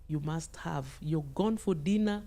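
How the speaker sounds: usual speaking voice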